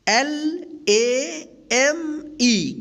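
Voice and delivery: very slow, drawn-out letters; spelling out. Shown as a demonstration. Teacher spells a word for pupils, slowly letter by letter. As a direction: stretch each letter and leave space after it.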